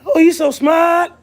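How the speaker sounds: high, loud, slow gushing quote; mock admiration. Streamer imitates people impressed by good French, mock admiration. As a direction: gush in a put-on impressed voice. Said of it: "and you be like".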